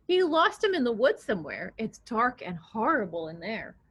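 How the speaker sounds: usual speaking voice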